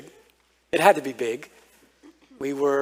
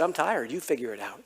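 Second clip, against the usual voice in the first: flat, softer, done-with-it dad voice; emotionless tired dad; 3 semitones lower, 8 dB quieter than usual. Retold or imitated. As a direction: hand the problem back without caring. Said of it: "no emotion".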